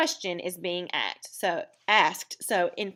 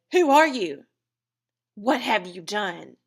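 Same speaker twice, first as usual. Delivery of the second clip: mock confrontation; 5 dB louder than usual. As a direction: confront the kidnapper, hammed-up. Example questions to child's abductor.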